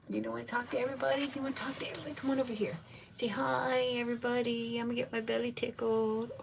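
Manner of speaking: high, fast cooing. Real, not acted. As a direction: coax the dog to say hi. Cooing to the dog.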